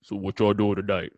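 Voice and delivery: imitating a gruff dad, raspy and low; a hint of Morgan Freeman with strep throat; deep raspy dad voice. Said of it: "his voice was like ... Morgan Freeman with strep throat".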